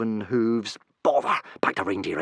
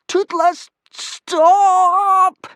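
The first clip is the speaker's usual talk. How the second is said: high, loud drawn-out cry; cried out; 10 dB louder, much slower than usual. Acted. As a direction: call the runaway dragon back. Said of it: "trying to shout and be quiet".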